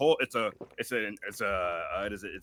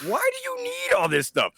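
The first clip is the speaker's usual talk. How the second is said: very high, squeaky question; asking her friend, way up high; squeaky disbelief. Retold or imitated. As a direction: squeak it out in shock. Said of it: "I'm like".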